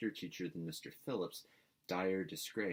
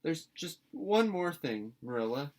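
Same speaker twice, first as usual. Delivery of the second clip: put-on a hopeful girl trying one more time; last-ditch plea; 9 semitones higher, 6 dB louder than usual. A narrator's reading. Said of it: "with the air of producing the last shot".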